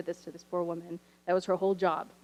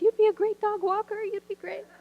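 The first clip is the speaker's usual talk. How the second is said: higher, overeager cheerleading voice; "You'd be a great dog walker" pitched way up, sing-song encouragement; gushing reassurance; 13 semitones higher, 4 dB louder than usual. Retold or imitated. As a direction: hype her up in a squeaky fan voice. Teacher reassures in high voice.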